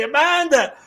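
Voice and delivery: name called out high and loud; excited call. Real, not acted. Host greeting a viewer from Down Under, high excited call. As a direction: hail her brightly by name.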